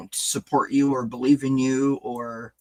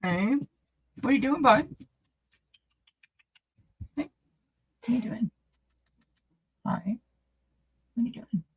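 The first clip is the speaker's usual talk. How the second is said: pet talk; 10 semitones higher, 4 dB quieter, faster than usual. Real, not acted. Asking the cat what it is doing, in high baby talk. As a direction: coo at the cat, higher and softer.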